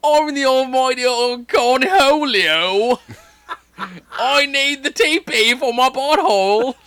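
high slow cartoon shriek; Cornholio impression. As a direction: stretch it out like Cornholio, cracking up. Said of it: "say it in her accent".

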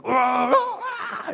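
high, laughing mock cry of pain; mock labor scream. Pastor imitating a woman screaming in labor, high mock cry. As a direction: ham up a woman's labor wail. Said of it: "and you're screaming".

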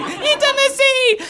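high, slow hammed-up wail of intimacy; mock scream. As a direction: wail it while running away. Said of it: "screaming, running".

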